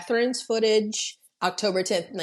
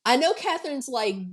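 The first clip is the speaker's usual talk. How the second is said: ordinary talk, nothing marked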